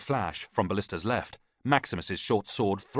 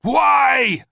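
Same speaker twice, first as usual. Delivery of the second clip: huge roar, much higher and louder; 13 dB louder than usual. A narrator's reading. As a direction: roar the question at the priest. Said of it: "Ballista roared".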